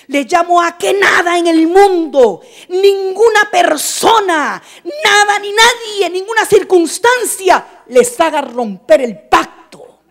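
loud, pleading preacher's appeal; urgent loud call. Real, not acted. Preacher calling the church to keep the covenant, loud. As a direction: plead with them at full voice.

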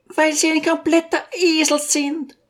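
very high outburst, no added volume; mock cry of exasperation, pitched high; exasperated Besjukina. A narrator's reading. Lady finally insults the teacher, exasperated high voice. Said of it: "schrie endlich die Besjukina ganz außer sich".

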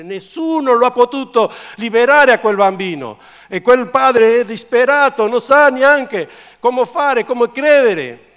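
loud high sermon outburst; impassioned preaching. Real, not acted. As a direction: preach it at full force. Preaches at full force.

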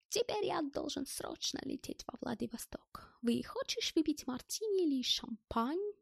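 soft, high, half-whispered foreigner's voice; elated German character. A narrator's reading. German offers Stas a drink, elated soft voice.

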